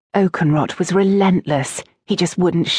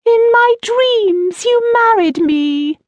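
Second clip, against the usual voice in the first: slow, dreamy mock-romantic squeak; very high, loud, drawn-out parody; mocking falsetto recital; 5 dB louder, slower than usual. A narrator's reading. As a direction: swoon through the verse in falsetto. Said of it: "reading from the beginning of the next stanza".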